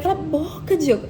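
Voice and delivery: mock telling-off without full volume; retold nervous snapping. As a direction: re-enact cutting him off in agitation. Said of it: "Eu fiquei muito nervosa essa hora".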